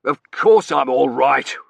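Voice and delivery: loud, slow indignant reply; irritated warden. A narrator's reading. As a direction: snap back as an offended official. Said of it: "he said, coughing".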